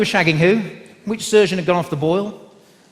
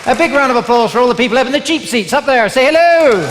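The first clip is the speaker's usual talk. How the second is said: loud, raised call to the balcony; calling to crowd; 6 semitones higher, 6 dB louder than usual. Real, not acted. Comic hails people in the cheap seats, loud rallying call. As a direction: hype them up, stretching the last word into a shout.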